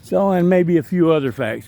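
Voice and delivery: ordinary talk, nothing marked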